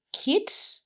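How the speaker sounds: ordinary talk, nothing marked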